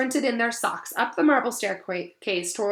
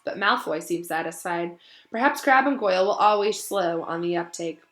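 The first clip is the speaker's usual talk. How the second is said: usual speaking voice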